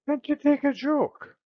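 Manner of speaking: cheeky suitor mocking the husband; Spanish accent. Paris voiced high.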